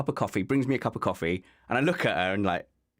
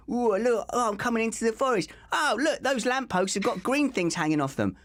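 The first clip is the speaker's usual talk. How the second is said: high, wondering tourist voice, much higher; wide-eyed Londoner; 13 semitones higher than usual. Pretend. Host mocks guest as a dazzled Londoner in the forest, high and wondering. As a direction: gasp at the forest like a city kid.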